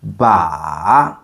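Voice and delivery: "ba" dipping low, then rising; long low scooping "ba"; third tone. Shown as a demonstration. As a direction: drop "ba" deep and bring it back up. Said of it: "going down and up".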